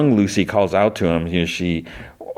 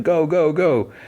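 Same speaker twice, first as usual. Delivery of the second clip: mock yell; 10 semitones higher, slower than usual. Dad yelling at soldiers to flee, in a high mock yell. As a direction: hurry them off with a lightly voiced yell. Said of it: "starts yelling at the few".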